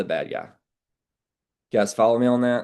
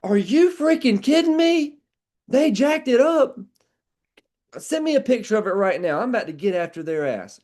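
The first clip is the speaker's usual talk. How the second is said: mock outrage; 13 semitones higher, 4 dB louder than usual. Roofer siding with angry customer, hammed-up outrage. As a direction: play up being furious on her side. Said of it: "It's like".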